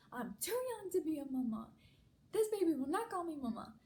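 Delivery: high, hammed-up mock outrage; indignant protest. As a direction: refuse it loudly as the affronted mom. Mother mock-protesting being a grandmother.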